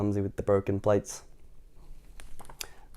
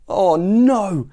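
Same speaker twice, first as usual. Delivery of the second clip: higher, louder horrified "oh no"; gasped dismay; 7 semitones higher, 8 dB louder than usual. A narrator's reading. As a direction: cry it out in mounting horror. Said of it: "he gasped".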